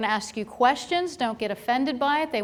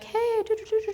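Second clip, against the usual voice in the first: breezy sing-song, much higher; mock dismissive; 10 semitones higher, 3 dB quieter than usual. Mocking staff who brush off a scared patient, high sing-song.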